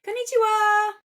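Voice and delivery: high, loud squeal of an anime fan; excited anime fan. Acted. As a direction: gush like a cute anime girl. Gushing over cute anime things.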